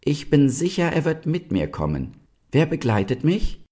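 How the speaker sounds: put-on high, clear young deer. A narrator's reading. Young deer volunteers to ask the stag, clear-voiced. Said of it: "sagte Ulla mit klarer Stimme".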